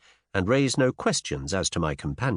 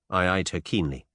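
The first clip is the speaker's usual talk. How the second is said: ordinary delivery, nothing marked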